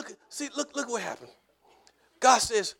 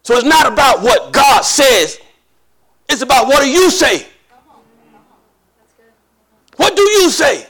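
full-force preaching; 3 semitones higher, 10 dB louder than usual. Real, not acted. Preacher urging the childless to sing, full-force preaching. Climax of the sermon.

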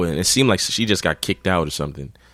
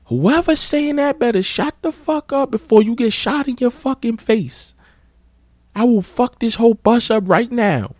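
very high mocking threat; imitating her threat. Girl threatens the hidden heckler, very high mock voice. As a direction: squeal the threat like a caricature. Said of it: "She comes back to the back".

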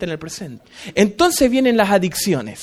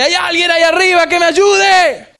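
acted shout upward; 7 semitones higher, 3 dB louder than usual. Hanging man calls up for rescue, shouting. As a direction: call up for help from far below. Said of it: "Y grita para arriba y dice".

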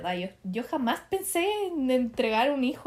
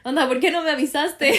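surprised; 4 semitones higher, 8 dB louder than usual. Retold or imitated. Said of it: "Y yo".

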